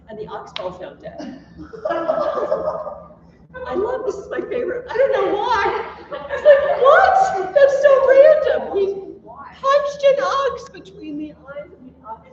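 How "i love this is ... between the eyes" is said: high, loud, laughing in disbelief; giggling, amazed and delighted; real laughter. Real, not acted. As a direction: crack up at how random it is. Teacher laughing at the absurd story.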